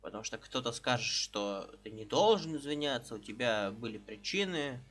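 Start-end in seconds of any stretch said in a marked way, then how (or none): none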